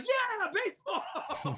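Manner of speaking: put-on high, gleeful kid's cheer; mock cheer. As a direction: cheer like an excited kid. Excited kid quoting movie line.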